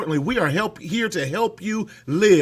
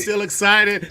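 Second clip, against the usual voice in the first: usual speaking voice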